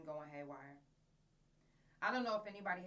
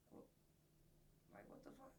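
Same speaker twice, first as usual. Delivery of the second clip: stunned whisper; 18 dB quieter, slower than usual. Retold or imitated. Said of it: "And I was like".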